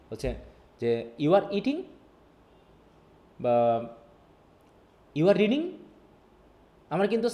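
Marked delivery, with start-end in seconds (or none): 1.2-1.8 higher pitch, EATING rising as a question; rising question tune. Shown as a demonstration. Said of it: "আমার টোনেই আমাকে কোশ্চেনটা করে দিচ্ছে". 5.2-5.6 higher, louder, READING rising as a question; rising question tune. Shown as a demonstration. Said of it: "আমার টোনেই আমাকে কোশ্চেনটা করে দিচ্ছে".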